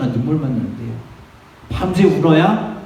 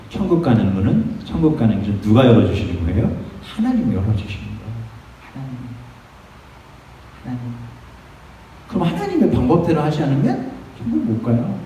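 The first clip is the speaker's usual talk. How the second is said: ordinary talk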